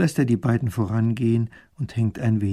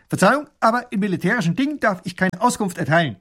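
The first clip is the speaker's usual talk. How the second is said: high-pitched soldier reporting stiffly; military rasping bark; 9 semitones higher, 4 dB louder than usual. A narrator's reading. Soldier refusing the minister information, military bark. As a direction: rattle it off like a soldier at attention. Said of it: "Der Mann schlägt die Hacken zusammen und schnarrt".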